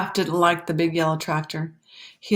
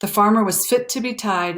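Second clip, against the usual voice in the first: ordinary delivery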